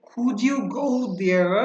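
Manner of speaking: could you go there drawn out, with "kujiu" for could you; linked pronunciation. Shown as a demonstration. Teacher names it phonology, slow linked could you. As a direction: slowly run could into you with a J. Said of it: "এটা হলো phonology".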